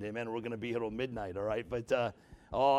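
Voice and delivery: normal talking voice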